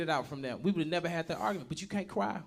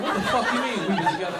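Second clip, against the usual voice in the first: louder mock-indignant reply; alarmed outburst; 2 semitones higher, 6 dB louder than usual. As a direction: act out a startled, angry comeback. Partner's alarmed reply to news.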